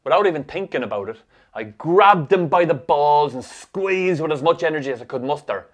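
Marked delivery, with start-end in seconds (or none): none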